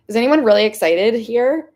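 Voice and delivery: loud, eager question to the chat; excited. Real, not acted. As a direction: burst out with excitement. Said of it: "I'm really fucking excited".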